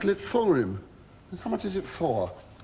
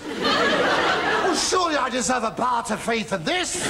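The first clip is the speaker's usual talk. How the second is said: hammed-up protest; 6 semitones higher than usual. Sold slave protests with a high hammed-up wail. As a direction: wail it like a martyr.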